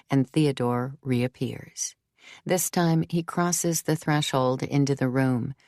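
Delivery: ordinary delivery, nothing marked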